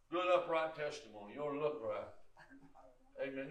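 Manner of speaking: usual speaking voice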